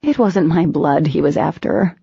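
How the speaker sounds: plain reading voice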